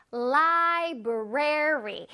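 library, each syllable drawn out slowly; slow syllable drill. Shown as a demonstration. Teacher modeling library slowly for children. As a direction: stretch out library for the kids. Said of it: "선생님이 알려줄게".

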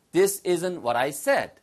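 very slow, said at the end; this isn't what I said, drawn out; slow model sentence. Shown as a demonstration. Teacher modelling the sentence slowly for learners.

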